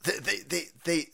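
ordinary talk, nothing marked

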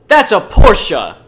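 slow, high, loud insistence; mock a pushy stranger; insistent shout. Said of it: "And she's like".

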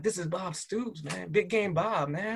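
high, hushed starstruck thrill; retold excitement. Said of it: "I was more excited".

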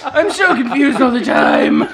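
put-on laughing, slow confused old man; mock dazed old-timer. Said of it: "ninety years old".

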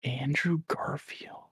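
slow, hushed questioning whisper; incredulous whisper. Real, not acted. Host questioning a friend's casting pick, incredulous whisper. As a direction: whisper the name back in disbelief.

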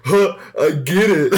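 slow, high, giggly echo; amused mock guffaw; laughing mimicry. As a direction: parrot it through helpless laughter. Listener mimics the blurted punchline.

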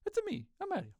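very high mock Italian accent; Mario. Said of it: "I'm like".

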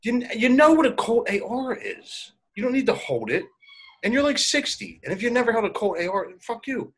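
retelling what he said to the old guy, exasperated mock scold; mock exasperated retort. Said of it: "And I'm like".

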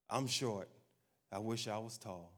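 soft, low self-pitying murmur; mock insecure self-talk. Preacher voicing someone insecure about height, mildly downcast. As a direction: voice a mildly downcast wish, quietly.

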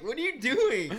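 high, loud, incredulous shout; loud mock disbelief. Friend yelling at drunk RJ, loud and high-pitched. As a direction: yell at him in mock disbelief. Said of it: "I'm like".

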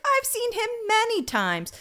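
high, eager doorman voice; gushing doorman. Retold or imitated. Doorman shown a photo, high eager gushing. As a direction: chirp it like a fan.

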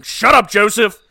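a father shushing his annoying son, loud and curt; fatherly shut up. Retold or imitated. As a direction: snap at the kid, not really mad. Dad silencing his kid.

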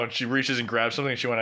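ordinary delivery

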